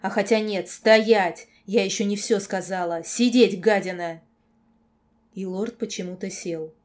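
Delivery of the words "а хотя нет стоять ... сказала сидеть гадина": loud, barked orders; angry commands. A narrator's reading. Girl not finished with the lord, shouting orders. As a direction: bark him back into his seat.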